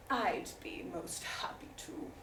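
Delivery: slow breathy whisper of a languid lord; slow airy whisper. Acted. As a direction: breathe it out slowly like a bored aristocrat. Lord's reply, whispered.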